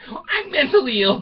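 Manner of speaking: high, loud jokey self-mockery; mock confession. Real, not acted. Laughing at himself after slipping up, high and loud. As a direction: blurt it out as a joke.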